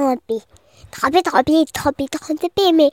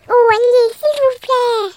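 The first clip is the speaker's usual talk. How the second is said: pleading crab voice; 7 semitones higher, 3 dB louder than usual. Acted. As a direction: beg sweetly like a cheeky crab. The crab insists.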